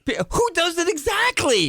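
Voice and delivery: can you believe it; host aghast at the twenty extra guests; incredulous cry. Real, not acted. Outrage at guest list.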